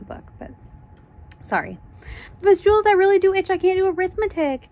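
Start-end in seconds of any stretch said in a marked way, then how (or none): 2.4-4.7 fast, high pleading whine; high-pitched rapid whiny complaint; whining. Acted. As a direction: whine it urgently, high and quick. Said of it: "Dana whined".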